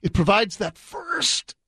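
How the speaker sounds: strained, exaggerated imitation leaning hard on "first"; mocking, over-emphasized impression; mock intestinal discomfort. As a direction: squeeze out "first" like a bellyache. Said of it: "she sounds like she might be having a little intestinal discomfort".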